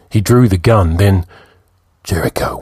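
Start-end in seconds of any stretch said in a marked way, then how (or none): 2.0-2.6 whispered urgent name; hushed alarmed call. Acted. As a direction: breathe his name in alarm.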